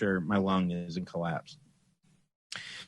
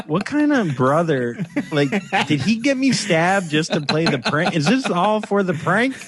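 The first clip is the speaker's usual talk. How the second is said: higher and higher, incredulous; rising mock outrage; 8 semitones higher than usual. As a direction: climb into disbelief at the prank. Outraged at brother's prank.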